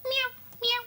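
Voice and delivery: tiny, very high, soft mews; little cat meow. Pretend. Finding a little Chloe Cat, tiny high meows. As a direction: squeak out small kitten meows. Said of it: "Oh, there's a little one".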